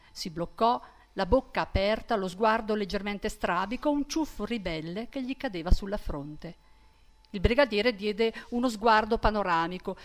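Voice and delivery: ordinary delivery, nothing marked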